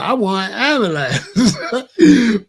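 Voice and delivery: slow, high, laughing request; laughing through it. Retold or imitated. Dying man answering his angel, laughing slowly. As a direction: laugh while pleading for help.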